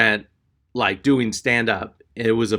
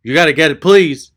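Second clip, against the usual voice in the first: loud, high begging; mock desperate pleading; 5 semitones higher, 9 dB louder than usual. Clerk begging customer to get insurance, mock desperate. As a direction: beg hard, hammed-up.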